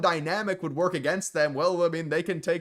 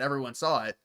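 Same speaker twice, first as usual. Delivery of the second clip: ordinary talk, nothing marked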